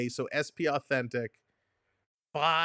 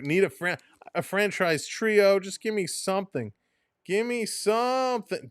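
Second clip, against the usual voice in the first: pleading; 7 semitones higher, 3 dB louder than usual. Real, not acted. Collector begging the pack for a good card, pleading. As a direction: beg with rising desperation.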